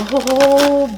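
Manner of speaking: ordinary talk, nothing marked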